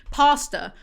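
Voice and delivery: Pastor with a long "ah", not "pasta"; Pastor pronounced properly. Shown as a demonstration. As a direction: say Pastor as "pah-stuh", loud and falling. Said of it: "Pastor, not pasta".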